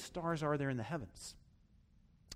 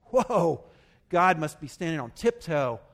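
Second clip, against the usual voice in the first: mock amazement; 2 semitones higher, 7 dB louder than usual. Preacher mocking pride in small good deeds, mock amazed. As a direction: exclaim as if wildly impressed, sarcastically.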